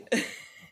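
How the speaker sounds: breathy burst of laughter; real laugh. Real, not acted. Storyteller cracking up over her nightmare, laughing. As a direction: laugh helplessly at your own story.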